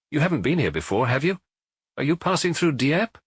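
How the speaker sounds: a hint of a friendly barman making small talk; lightly voiced bar owner. Proprietor questions Maigret.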